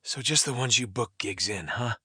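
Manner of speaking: low, dry sarcasm; sigh, then say it low and sarcastic; understated sardonic dig. A narrator's reading.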